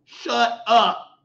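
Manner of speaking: normal talking voice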